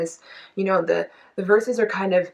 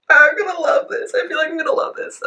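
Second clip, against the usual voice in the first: much higher, louder squeal of anticipation; thrilled; 10 semitones higher, 7 dB louder than usual. Retold or imitated. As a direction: squeal with excitement.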